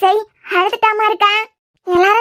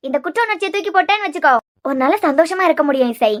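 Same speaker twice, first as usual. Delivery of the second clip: ordinary talk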